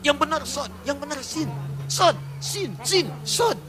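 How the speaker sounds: mock bickering. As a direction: imitate two reciters arguing back and forth.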